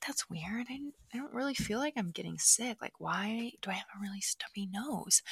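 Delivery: whispered, puzzled aside to herself; soft whisper, wondering what's wrong; hushed inner thought. Retold or imitated. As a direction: breathe it out like a secret worry. Bedtime thought about stuffy nose.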